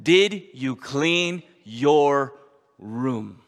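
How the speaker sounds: drawn out slowly, Did leaned on, room dropping low; very slow patient question. Retold or imitated. Father to daughter, very slow patient question. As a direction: stretch each word, room falling low.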